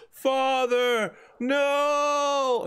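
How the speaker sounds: high, drawn-out hammy wail; dramatic anguished cry. Acted. Son calls to his falling father, hammed-up anguished cry. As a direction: stretch each word into a high cry.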